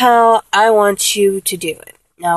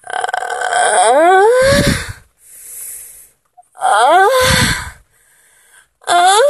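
moans climbing from vocal fry to much higher head voice; moans rising from fry to head voice; 11 semitones higher, much slower than usual. Shown as a demonstration. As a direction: start in fry, rise into head voice. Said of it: "Let me show you the demonstration again".

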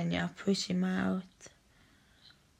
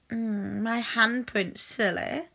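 usual speaking voice

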